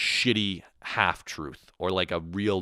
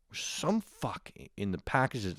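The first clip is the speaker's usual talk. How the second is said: usual speaking voice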